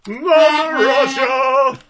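put-on slow, loud Russian accent shout; hammed-up Russian accent. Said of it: "done a Russian accent".

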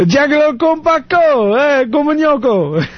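high, sing-out imitation of Ugandans; mimicked Ugandan speech. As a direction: chatter high in a Ugandan accent. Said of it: "That's what they say".

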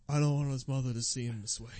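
quiet grief. Shown as a demonstration. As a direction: keep it low and hurting.

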